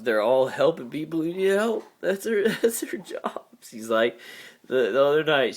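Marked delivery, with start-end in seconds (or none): 2.0-3.4 high, whispered half-laugh, half-sob; crying and laughing at once; laughing through tears. Real, not acted. Praising his mom to viewers, laughing through tears. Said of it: "I'm sorry about the crying".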